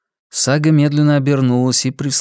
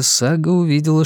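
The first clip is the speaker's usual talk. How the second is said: ordinary delivery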